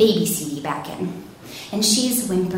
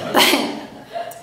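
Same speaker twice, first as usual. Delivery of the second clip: usual speaking voice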